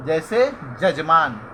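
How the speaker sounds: ordinary talk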